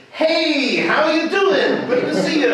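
loud, high, drawn-out American greeting; hammed-up wild American hollering hello. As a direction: put on an over-the-top American act. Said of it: "a wild American".

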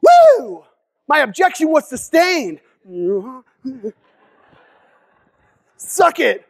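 high whoop then slow gloating brag; celebrating lawyer. Retold or imitated. A lawyer celebrating a sustained objection, whooping and gloating. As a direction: showboat like a touchdown dance. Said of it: "you don't see lawyers doing this".

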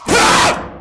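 loud, very high scream; startled scream. Real, not acted. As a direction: scream out in sudden fright. Startled in scary game.

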